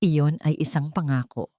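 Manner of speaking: usual speaking voice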